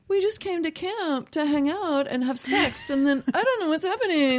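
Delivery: high-pitched mock whine of a doomed camper; panicking slasher victim. Doomed camper who just came to hang out, mock panic.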